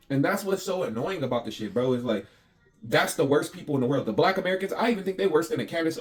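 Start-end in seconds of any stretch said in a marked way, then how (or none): none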